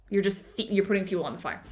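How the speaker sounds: ordinary talk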